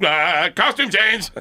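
strained plea. Retold or imitated. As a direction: drag it out, desperate. Strained retelling after the kiss.

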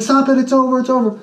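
high yelled commands to break up a fight; retold shout. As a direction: bark at the girls to let go.

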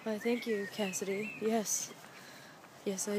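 soft, mock-romantic murmur to herself; whispering sweet nothings. Alone in the rose garden, soft mock-romantic murmur. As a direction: coo it softly to yourself, playing lovers. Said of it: "whispering sweet nothings to myself".

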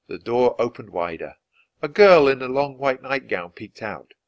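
ordinary delivery

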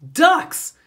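high, loud shouted answer; yelled. A narrator's reading. Michael yelling to Clancy over the phone, high and loud. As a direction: bellow it down the phone. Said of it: "yelled Michael".

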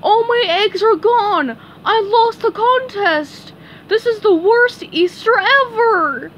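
high, loud cartoon wail; Patrick crying. A narrator's reading. Patrick cries over his eaten eggs, mock wailing. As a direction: wail it like a hammed-up tantrum. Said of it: "he began to cry".

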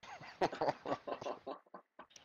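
breathy laugh at a crude joke; burst of laughter. Real, not acted. Friend laughing at the crude punchline, breathy laughter. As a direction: crack up, mostly breath.

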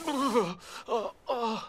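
soft, whimpering moans; quiet hurt groaning; pained whimper. Acted. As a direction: whimper softly after a painful fall. Hurt after the crash.

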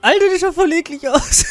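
high laughing disgust. Real, not acted. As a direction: laugh it out, grossed out.

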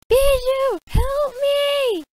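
high loud whisper-yelled plea; whisper yelling. Acted. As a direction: cry for help in a hushed yell. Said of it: "fake whisper yell I do for Hamtaro".

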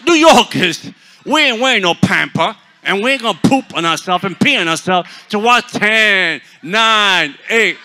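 loud, high, hammed-up proclamation; mock rallying shout. Preacher mocking the ball-drop crowd, hammed-up loud shout. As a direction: belt it out in mock defiance.

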